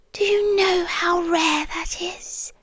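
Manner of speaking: soft intense whisper. Acted. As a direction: breathe the question close to their ear. Captor confiding intensely to captive.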